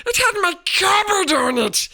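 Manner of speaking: high, loud angry shout. A narrator's reading. Boy angrily shouting at his uncle about the cupboard. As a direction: keep shouting in anger. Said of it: "said Harry angrily".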